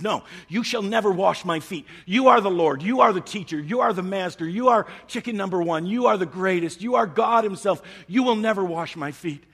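high, loud, insistent refusal; Peter's protest. Retold or imitated. Peter refusing to let Jesus wash his feet, high and loud. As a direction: shout him down in disbelief. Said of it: "Peter says".

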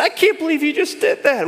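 high, indignant disbelief; lightly hammed judgment; mock judgmental. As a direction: play the self-righteous onlooker. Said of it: "somebody else is pointing at us".

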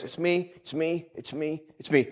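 soft hushed murmur, over and over; murmured repeating. Retold or imitated. Preacher imitating a woman murmuring at the tent meeting, hushed. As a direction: quietly mutter it to yourself. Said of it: "started to walk over and started going like this".

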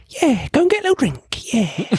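mock sneering [name]. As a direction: sneer it like a spoilt star. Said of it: "and he's just like".

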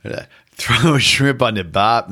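jokey fake Australian accent on request; mock Australian accent. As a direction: toss off a cheesy Aussie line in a put-on Australian accent. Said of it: "Can you try it again?".

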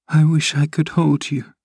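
clinging to him, bitterly. A narrator's reading. Dying Catherine to Heathcliff, bitter. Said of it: "she continued bitterly".

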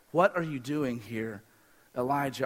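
low and soft, gently asked; hushed divine voice; quiet voice of God. A narrator's reading. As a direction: ask it gently from the stillness. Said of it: "the sound of a low whisper".

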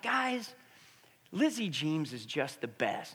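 slow, lightly voiced little boy; a hint of a small boy gushing about his friend; imitating young son. Dad voicing son from back of van, lightly childlike.

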